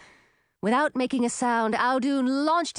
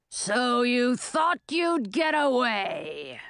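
slow, menacing dragon voice; dragon growl; much slower than usual. A narrator's reading. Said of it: "Frosty Breath growled".

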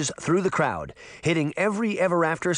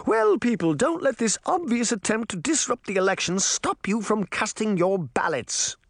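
high, imperious public address; rally the voters from the podium; raised queenly speech; queen addressing a crowd; 8 semitones higher than usual. A narrator's reading.

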